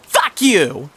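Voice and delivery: high-pitched falling curse; acted bratty outburst; angry. A narrator's reading. Martha curses T D.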